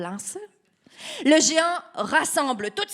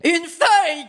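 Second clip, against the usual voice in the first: louder scornful giant shout; 5 semitones higher, 6 dB louder than usual. Acted. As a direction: bellow it in mockery. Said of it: "crie le géant".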